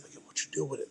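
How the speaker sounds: very soft secret question; hushed whisper. Retold or imitated. Friend in back of police van, hushed whisper. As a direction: ask it under your breath.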